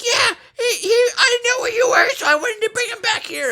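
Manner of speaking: put-on high, loud old-lady voice; shrill mock of the old neighbour lady; mimicking Arlene. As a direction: squawk it back like a frantic old woman. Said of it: "she's like".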